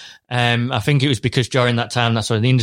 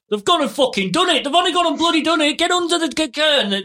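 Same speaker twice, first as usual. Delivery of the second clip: much higher, hammed-up panicking farmer; panicked farmer; faster than usual. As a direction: yell it like a farmer seeing the bomb. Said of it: "all the guys on the farms and stuff going".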